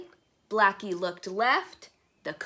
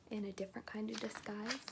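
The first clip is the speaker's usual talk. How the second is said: fast, unvoiced whisper; secretive hint; 13 dB quieter, faster than usual. Real, not acted. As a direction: tease the hidden clue. Hinting at the picture.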